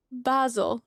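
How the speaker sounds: basil as BAH-zul; fancy British pronunciation. Shown as a demonstration. Streamer quoting how some viewers say it, basil as BAH-zul. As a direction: open first vowel in basil, ah not ay. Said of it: "I know some of you say".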